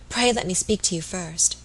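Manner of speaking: ordinary delivery, nothing marked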